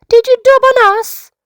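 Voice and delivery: high, loud accusing yell; child's angry shout. Acted. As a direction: demand it furiously across the pool. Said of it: "Bevan shouts".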